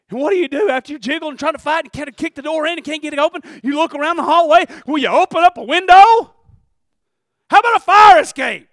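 loud, high preaching that keeps climbing; preaching at full force. Acted.

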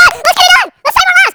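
high, loud mock emergency cry; frantic call to action; urgent rallying shout. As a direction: yell like a crowd fighting a blaze. Acts out people rallying at fire.

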